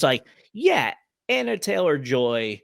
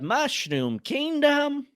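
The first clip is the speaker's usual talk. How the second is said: high, slow cartoon Mario voice; mock Italian plumber announcing the kingdom; 12 semitones higher, much slower than usual. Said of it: "'cause it sounded like".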